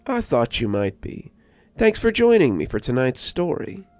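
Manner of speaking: a spooky storyteller signing off; host sign-off. Acted. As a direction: lean in like a late-night horror host. Channel host outro after story.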